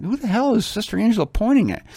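high, baffled question; bewildered classmate looking around; puzzled. Retold or imitated. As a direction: ask it as a confused student. Students puzzled by pointing.

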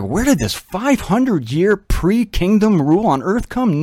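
ordinary delivery

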